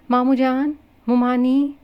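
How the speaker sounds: high, loud call for help; loud shout. A narrator's reading. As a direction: yell out to the elders. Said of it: "وہ زور سے چلائی". (ماموں کو زور سے پکارتی چیخ)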